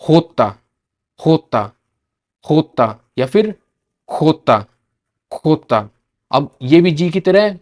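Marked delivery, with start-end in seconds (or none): 0.0-5.9 होता then खोता, Spanish "jota" with a throaty kh; Spanish letter name. Shown as a demonstration. Teacher to students, Spanish jota. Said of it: "जे को क्या बोलते हैं".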